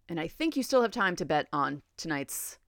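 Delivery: ordinary delivery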